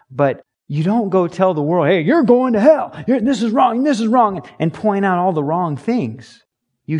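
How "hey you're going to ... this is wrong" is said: high, loud finger-wagging preaching; hammed-up hellfire scolding; mock condemnation. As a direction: scold the world like a street preacher. Acted preacher telling world off.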